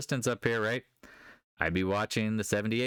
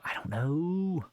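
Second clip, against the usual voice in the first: drawn-out shrug; 6 semitones higher, much slower than usual. Real, not acted. Shrugging about Exxon.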